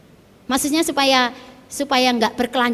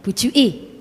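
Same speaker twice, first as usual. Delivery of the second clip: Surabaya slang. Shown as a demonstration. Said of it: "Kalau bahasanya Surabaya apa?".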